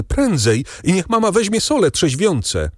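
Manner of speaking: urgent call pitched well up; wołanie. A narrator's reading. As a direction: hurry her along in a high voice. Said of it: "zawołała Valentin macochę". (naglące, wysokie wołanie)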